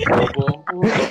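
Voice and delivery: loud mock insult at a friend; loud curse. Real, not acted. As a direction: shout the insult out. Shouted insult among friends. (sigaw na mura)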